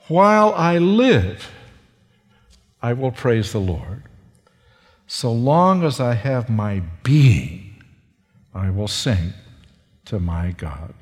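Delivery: solemn scripture quote; psalm recitation. Acted. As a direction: recite the verse reverently.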